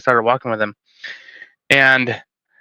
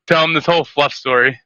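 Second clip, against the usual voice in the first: ordinary talk, nothing marked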